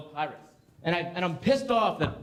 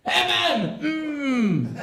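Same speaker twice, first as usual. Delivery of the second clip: mock outrage; 8 semitones higher, much slower than usual. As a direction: spit out the letters in disbelief. Outraged at the two-letter word.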